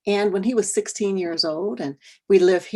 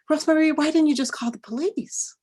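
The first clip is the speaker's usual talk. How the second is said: much higher, breezy naive question; 9 semitones higher than usual. Retold or imitated. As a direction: voice a friend who just doesn't get it. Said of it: "She goes".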